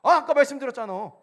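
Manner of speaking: acted loud, high-pitched outburst; losing patience. Son snapping at father who asks again, exasperated. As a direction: blurt it out, unable to hold back. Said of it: "그래서 내가 그때 못 참고".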